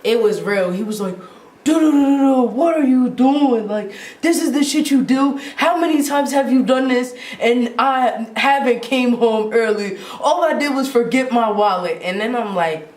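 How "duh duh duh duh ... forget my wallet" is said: raised, higher-pitched retold scolding; mock angry dad rant; imitating mad dad. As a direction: mimic a dad chewing out his kid. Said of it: "my dad was just mad".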